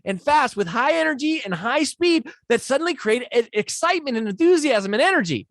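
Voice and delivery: high, loud and full of enthusiasm; loud, high energy. Shown as a demonstration. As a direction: pump the energy right up to excite the room. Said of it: "And so you talk like this".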